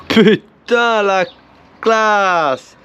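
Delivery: excited. Real, not acted. Amazed at clean part.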